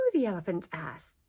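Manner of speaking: ordinary delivery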